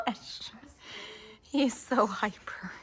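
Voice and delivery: whispered, slow aside. Real, not acted. Mother whispering about her hyper baby.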